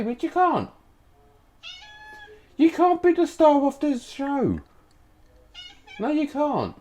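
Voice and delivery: high squeaky pet-talk voice; talking to the cat. Real, not acted. Scolding the cat away from the recording, high pet-talk voice. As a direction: coo at the cat high and sing-song.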